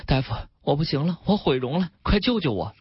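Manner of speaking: lightly voiced plea of a panicked patient; understated tearful urgency; distressed patient. A narrator's reading. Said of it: "患者大哭不止，情绪异常紧张".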